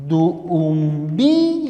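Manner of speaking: duumbiro drawn out slowly, the double u stretched; slow example word. Shown as a demonstration. Teacher sounds out the example slowly, vowels stretched. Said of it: "Y aquí colocamos".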